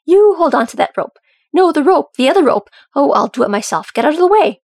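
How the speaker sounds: loud, high, exasperated commands; irritated barking orders. A narrator's reading. Dory takes over from clumsy soldiers, loudly irritated. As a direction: order them about, fed up. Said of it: "He knew that tone".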